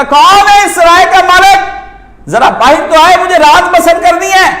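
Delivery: high, loud dervish cry; beggar calling out. Retold or imitated. Dervish calls loudly asking for the inn's owner. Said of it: "اس نے کہا".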